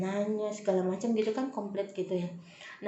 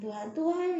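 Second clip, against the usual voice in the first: calling to God; 8 semitones higher than usual. Retold or imitated. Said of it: "sampai gitu tuh sama Tuhan".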